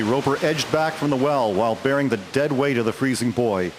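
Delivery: usual speaking voice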